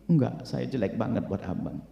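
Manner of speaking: slow, low, soft refusal; self-blaming wife. Acted. Wife telling husband she is unworthy, ashamed and hushed. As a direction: murmur it as if ashamed.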